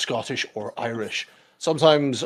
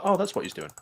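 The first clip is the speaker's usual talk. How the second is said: high, sudden light-bulb voice, imitating a newcomer; a playful, higher "aha" voice; mock realization; 8 semitones higher, faster than usual. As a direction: play the newbie who finally gets it. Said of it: "they're like".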